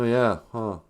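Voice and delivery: ordinary talk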